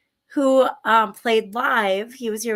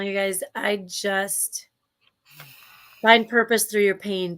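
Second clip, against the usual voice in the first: normal talking voice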